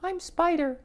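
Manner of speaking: very high, squeaky little voice; little spider introducing itself, very high; tiny spider voice. Acted. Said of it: "in a quiet, high-pitched voice".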